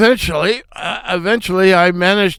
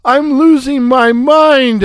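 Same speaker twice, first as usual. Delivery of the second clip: much higher, louder wail; hammed-up cry of pain; 9 semitones higher, 5 dB louder, much slower than usual. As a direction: wail like you're losing it. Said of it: "I cried".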